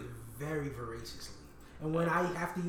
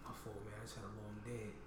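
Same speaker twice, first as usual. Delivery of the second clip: meek apology; 7 semitones lower, 15 dB quieter than usual. Retold or imitated. Stranger on the train backing down, soft meek apology. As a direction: apologize meekly to avoid a fight.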